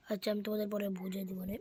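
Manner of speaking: quick whisper to the viewers; whispered aside. Real, not acted. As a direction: hush it fast, a quick aside.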